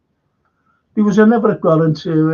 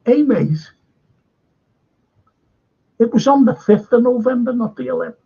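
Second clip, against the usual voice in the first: higher, smug correcting voice; mock know-it-all pub fella; 7 semitones higher than usual. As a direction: imitate a pub bloke who thinks he knows better. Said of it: "this fella comes down and went".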